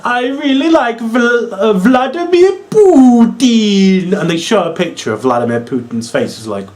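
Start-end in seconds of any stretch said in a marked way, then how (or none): 0.0-4.2 higher, slow mock Russian gushing; hammed-up adoring Lana, higher. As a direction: drag it out like a starstruck fan. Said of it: "She's like".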